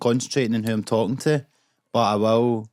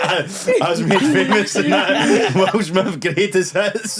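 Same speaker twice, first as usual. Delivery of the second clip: laughing; 8 semitones higher, 4 dB louder, slower than usual. Pretend. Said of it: "laughing mock [name] bit".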